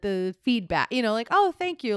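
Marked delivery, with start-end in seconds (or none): 1.3-1.9 high-pitched feigned gratitude; mock gracious thanks. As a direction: accept praise as if you earned it. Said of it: "as if she did do it".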